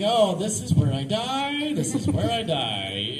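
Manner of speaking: slow, drawn-out doomed moan; hammed-up slow fear; mock dread. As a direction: resign yourself to death, slowly. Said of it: "I'm like".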